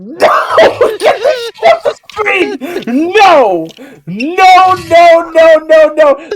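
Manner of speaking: frantic high shouting; crying with rage. Real, not acted. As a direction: yell in laughing panic. Streamer panics over clip on screen.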